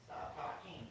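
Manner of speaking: usual speaking voice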